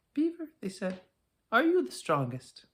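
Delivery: put-on high child's call. Curious child calling the beaver, high.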